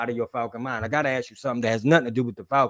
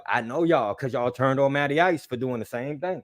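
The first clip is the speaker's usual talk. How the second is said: normal talking voice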